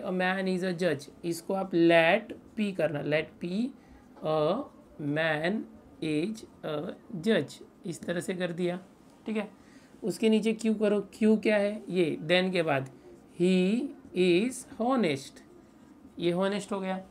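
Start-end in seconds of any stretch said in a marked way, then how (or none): 3.0-7.6 very slow, word-by-word dictation; dictating. Real, not acted. Teacher gives students the statement to write, dictating slowly. 13.4-15.4 very slow, stretched words; dictating. Real, not acted. Teacher has students write statement Q, dictating slowly.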